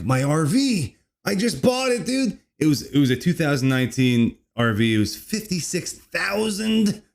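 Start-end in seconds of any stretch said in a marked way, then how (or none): 0.0-2.4 panicked retelling. Retold or imitated. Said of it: "I'm like".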